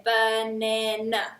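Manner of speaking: "Banana" spoken slow in three even beats; syllables split. Shown as a demonstration. Teacher repeating banana syllable by syllable. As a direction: lay out "Banana" as ba-na-na.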